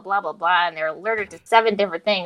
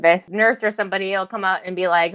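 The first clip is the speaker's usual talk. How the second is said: ordinary delivery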